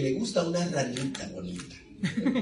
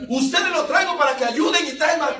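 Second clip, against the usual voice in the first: higher exasperated king scolding; the king scolds his magicians for bringing more frogs; 8 semitones higher than usual. Acted. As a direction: berate the useless sorcerers.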